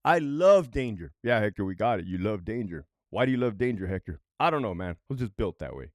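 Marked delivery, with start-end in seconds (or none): 1.2-4.2 mock bored viewer. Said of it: "mocking viewers' reply".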